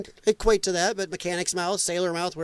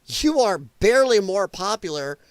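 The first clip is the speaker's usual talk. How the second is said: ordinary talk, nothing marked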